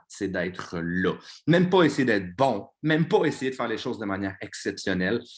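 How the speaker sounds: loud, driving coach's pep talk; fired-up pep talk. Real, not acted. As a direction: preach it to the team at full force. Pep talk at full force.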